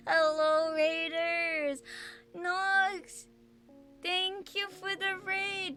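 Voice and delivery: high, bright cute greeting; sweet high welcome voice; cute anime streamer. Acted.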